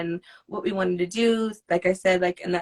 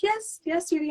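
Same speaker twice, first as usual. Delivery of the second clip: put-on sweet elderly voice; 10 semitones higher than usual. Imitating older singer on phone.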